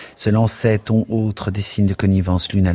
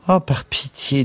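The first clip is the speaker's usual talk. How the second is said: sighing; 7 semitones higher than usual. A narrator's reading. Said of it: "soupira mademoiselle Douce".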